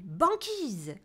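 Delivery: loud cry of the word; shouted wish. A narrator's reading. Seal shouts his wish as he dives, loudly. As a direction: shout it coldly. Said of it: "il crie".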